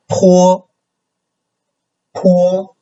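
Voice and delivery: PO held very high and level; high, steady PO; first tone. Shown as a demonstration. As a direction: say PO on one high, flat pitch. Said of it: "the first tone is high".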